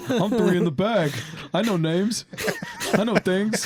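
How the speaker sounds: jokey tipsy swagger; mock drunken boast. Joking boast about drinking, laughing.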